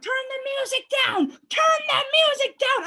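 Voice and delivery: high, loud mocking cop voice; shrill mock of the policewoman. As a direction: parody the officer's nagging order. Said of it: "she's like".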